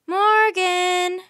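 high, loud, drawn-out call; shout. A narrator's reading. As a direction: shout a name up to the treetop. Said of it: "shouted Annie".

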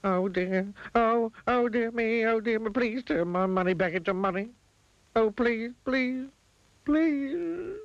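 put-on high, pleading old miser; a high, desperate plea; begging miser. A narrator's reading. Miser begging the wizard to undo the spell.

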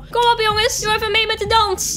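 rally the friends onto the dance floor; eager urging. Real, not acted. Alone on the dance floor, calls friends to join, eager.

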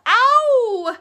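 high, loud storybook "ow"; long acted cry of pain; mock pained yelp. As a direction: yelp as if pricked, hammed-up. Owl pricked by hedgehog spines.

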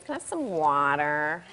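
put-on daughter asking in a light American accent; "water" with an American flapped T. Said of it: "she goes".

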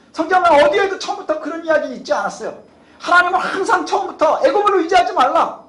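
high-pitched, insistent pulpit delivery; forceful preaching. Real, not acted. Preacher warning that God's word never changed, forceful preaching. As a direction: drive each sentence home at a sustained high pitch.

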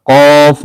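কফ with a thick, heavy throat and long rounded "o"; heavy, held "qawf" from far back. Shown as a demonstration. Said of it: "আমাদের গলাটা মোটা করে".

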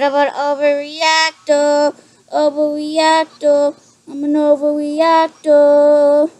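slow, drawn-out panicky whine; scared whining. Real, not acted. Player admits overreacting to the game, scared whining. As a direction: wail each word out slowly in fear. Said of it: "too scared".

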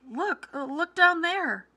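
high, loud, drawn-out call; crying out. A narrator's reading. Mrs. Gregg urging her husband to look down, crying out. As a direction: call out urgently, pitched high. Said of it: "she cried".

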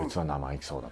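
very low, hushed imitation of a watchful senior; 目をつける先輩. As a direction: mark the newcomer in a low undertone. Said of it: "その代わりもう目つけてるんですよ。". (先輩の陰口を低い小声で再現)

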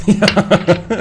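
high laughing yes. Real, not acted. Laughing at friend's joke about school. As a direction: laugh through the word.

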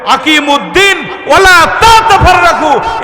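loud, high shouted command; scolding rebuke. Acted. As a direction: thunder the verse out as a reprimand. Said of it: "ধমক দিয়ে বলছেন".

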